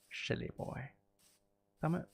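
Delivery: ordinary talk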